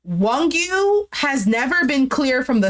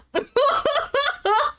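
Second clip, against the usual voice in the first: high burst of real laughter. Real, not acted. Host reacting to his line about lying, real high laugh. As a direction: crack up in a high-pitched giggle.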